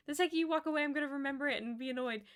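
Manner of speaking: loud mock frustration; exasperated. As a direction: rush it, annoyed at yourself. Retold self-talk forgetting name.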